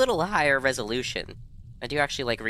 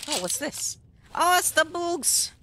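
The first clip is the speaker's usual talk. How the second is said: put-on squeaky baby-talk delight; cutesy high voice; slower than usual. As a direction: coo over them like tiny pets. Greeting cute little creatures.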